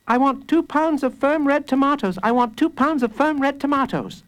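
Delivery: put-on high child voice; high-pitched, dutiful kid; little boy. A narrator's reading. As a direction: recite the order as a small boy. Small boy orders, says everything twice.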